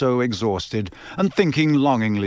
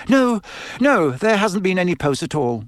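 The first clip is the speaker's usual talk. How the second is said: high, flustered motherly reply; reply as if preoccupied; distracted; 6 semitones higher than usual. A narrator's reading. Mother answering Harry about post, distracted. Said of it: "said Missus Weasley distractedly".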